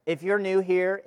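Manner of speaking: normal talking voice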